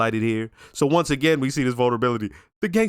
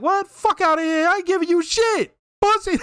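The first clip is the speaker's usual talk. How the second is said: defiant hotel owner. Retold or imitated. Hotel owner telling Tony off, in a high mock-tough voice. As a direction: squeak it out like a fearless old man brushing off threats.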